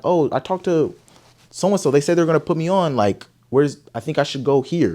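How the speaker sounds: pleading explanation. Retold or imitated. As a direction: talk your way onto the list. Explaining he was promised a slot.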